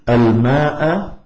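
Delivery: الماء drawn out slowly to a final "a"; الماء ending on a rising fatha; accusative ending. Shown as a demonstration. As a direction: recite الماء as al-maa'a, slow and rising. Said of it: "من الرفع إلى النصب".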